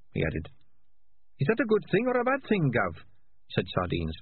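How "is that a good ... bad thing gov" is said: timid high squeaky voice; eager but meek underling; meek high rat. Acted. Sardines asks Dark Tan.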